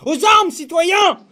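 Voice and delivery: slow, loud call to arms; rallying shout. Acted. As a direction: bellow it like a revolutionary. Rallying cry in mock speech.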